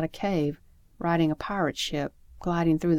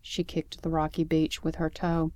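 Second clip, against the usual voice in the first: ordinary delivery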